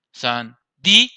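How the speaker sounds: "Sandía" said slowly, leaning on the í; slow teacher demo of "Sandía"; slow stressed demo. Shown as a demonstration. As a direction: draw out "Sandía" with weight on the í. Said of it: "tiene la mayor fuerza en la vocal cerrada".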